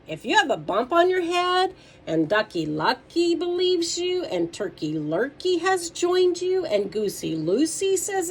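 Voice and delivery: loud, lifted storytime voice; lively read-aloud for kids; animated children's story reading. A narrator's reading. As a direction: read it out big for little listeners.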